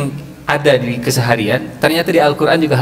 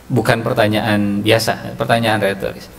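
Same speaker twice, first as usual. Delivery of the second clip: ordinary talk, nothing marked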